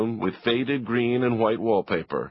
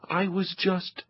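put-on flustered boy; 9 semitones higher, 3 dB quieter than usual. Leo cut off again.

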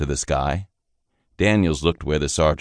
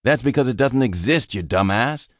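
higher, scornful put-down; spring the joke on the rookie; mocking sergeant; 8 semitones higher than usual. Sergeant mocking the private after fooling him, scornful. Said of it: "cuffing the private in the back".

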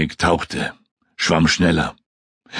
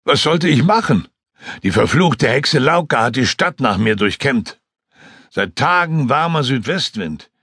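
higher, eager flier's voice; excited pilot reliving the fall; Joskun recounting; 5 semitones higher than usual. Acted. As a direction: tell the crash story in a rush. Joskun explains his flight.

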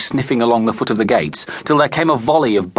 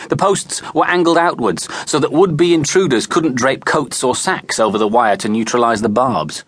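usual speaking voice

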